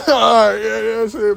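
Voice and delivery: loud laugh into the words. Real, not acted. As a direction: laugh it off loudly. Caught, laughing it off.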